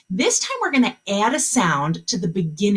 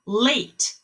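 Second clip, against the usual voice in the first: ordinary talk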